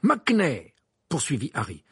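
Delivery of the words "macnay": high, loud accusation; insistent naming. Acted. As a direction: throw the name out loudly. Harry calls out another name.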